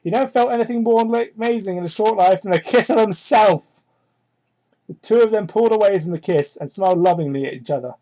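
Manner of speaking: ordinary talk, nothing marked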